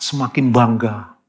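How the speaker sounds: ordinary talk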